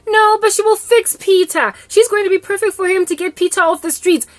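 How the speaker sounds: high, loud gushing mother; mother compliments the girl, high and loud; cooing mom. Retold or imitated. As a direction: translate her gush, still high and loud. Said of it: "mother insists".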